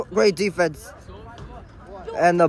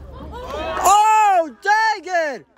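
frustrated shout; 12 semitones higher, much slower than usual. Real, not acted. Frustrated fan at soccer game.

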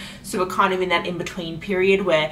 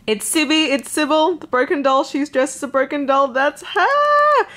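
high, building to a loud squeal; thrilled recognition; 6 semitones higher, 4 dB louder than usual. Real, not acted. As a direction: squeal as a favorite character appears. Thrilled recognition of a favorite character.

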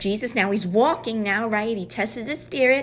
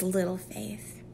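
soft, gentle "Little faith", much softer, with a slight rise; softly voiced Jesus, gentle; gentle rebuke; 2 semitones lower, 9 dB quieter, slower than usual. Shown as a demonstration. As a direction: tenderly say "Little faith" to a frightened friend. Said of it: "look how beautiful he tells them".

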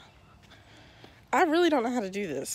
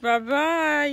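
calling out; 5 semitones higher, 7 dB louder than usual. Real, not acted. Saying bye to the dog.

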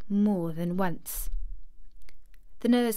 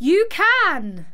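high, loud, forceful retort; furious raised-voice contradiction; shout; 9 semitones higher, 7 dB louder than usual. A narrator's reading. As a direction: shout it back at the sobbing child. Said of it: "shouted Mary".

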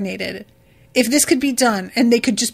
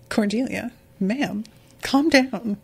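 usual speaking voice

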